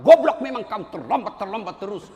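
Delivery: loud mock berating; mock insult. Lecturer curses a late student, loud mock anger. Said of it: "coba kalau dimaki-maki".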